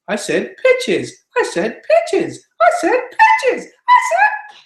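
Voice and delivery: climbing step by step into a very high squeak. Shown as a demonstration. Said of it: "taking your voice higher and higher".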